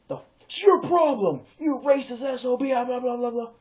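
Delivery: hammed-up accusation, voice pushed up; mock outrage. Imitating people yelling at him over a joke, hammed-up outrage.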